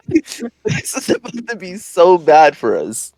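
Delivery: slow, through laughter; laughing. Real, not acted. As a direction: laugh your way into the line. Laughing as he retells the danger.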